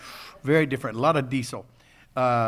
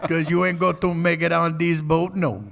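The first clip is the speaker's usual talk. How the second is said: Cajun captain; 4 semitones higher, 5 dB louder than usual. Retold or imitated. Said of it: "He go".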